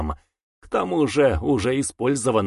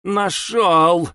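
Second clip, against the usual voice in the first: high, loud eager cry; excited exclamation; 6 semitones higher, 4 dB louder than usual. Acted. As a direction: shout it out triumphantly. Boy exclaims finding sphere.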